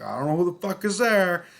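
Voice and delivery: high, loud, frantic cry while blinded; retold terrified outburst; panicked yell. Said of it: "going like".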